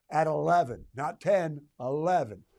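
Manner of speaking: hammed-up emphatic eleven; stress at eleven. Stress level joke, Spinal Tap.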